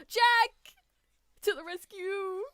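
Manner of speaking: high, loud heroic cry; shouted name, then high rallying call; excited cheer. Real, not acted. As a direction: cheer the hero on. Cheering a character's arrival.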